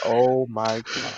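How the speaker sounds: drawn-out, dropped oh my God; mock horrified hush at own voice; slow dismayed realization. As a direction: let the shock sink in slowly. Hearing own voice on video.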